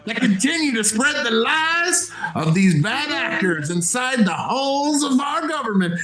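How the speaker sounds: lightly voiced old cowboy, high; old prospector. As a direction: keep the frontier old-timer going. Continues cowboy character bit.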